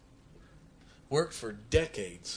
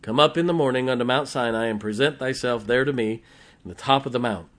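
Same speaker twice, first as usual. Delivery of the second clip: louder, measured King James reading; scripture reading; 5 dB louder, faster than usual. Acted. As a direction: proclaim the verses to the congregation. Reads scripture aloud.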